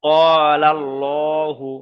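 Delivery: ক্বালাল্লাহু drawn out slowly, with a full, dark "llo"; full heavy L. Shown as a demonstration. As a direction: say ক্বালাল্লাহু with a heavy, rounded "llo", vowels held long. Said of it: "পুর করে পড়তে হবে".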